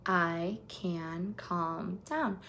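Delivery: slow, soft, soothing mantra; calm self-talk. Shown as a demonstration. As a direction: say it slowly and gently to calm yourself down. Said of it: "she actually says".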